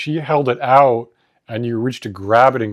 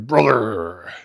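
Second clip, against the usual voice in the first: gruff mock wrestler growl; lightly voiced constipated wrestler; pro wrestler voice. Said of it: "a pro wrestler voice where it's like".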